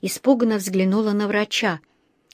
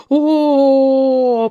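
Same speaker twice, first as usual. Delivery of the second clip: long, loud, higher "oooh"; echoing wail; 5 semitones higher, 6 dB louder than usual. A narrator's reading. Bear repeating the dentist's cry, loud drawn-out "oooh". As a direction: copy the howl with mouth held open. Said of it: "повторил Паддингтон".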